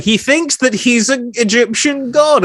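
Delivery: lightly voiced orderly brushing him off; a hint of a doctor's dismissive tone; mock doctor. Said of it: "they can just be like".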